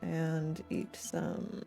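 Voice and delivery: normal talking voice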